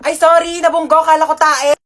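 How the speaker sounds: usual speaking voice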